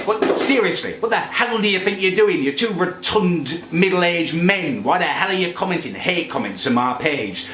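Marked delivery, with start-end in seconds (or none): 0.1-2.4 higher, exasperated demand; indignant rant. Real, not acted. Berating two trolls on his Facebook page, indignant. 2.4-4.8 slow, higher, deliberate contempt; slow insult. Real, not acted. Insulting two Facebook trolls, slow contempt.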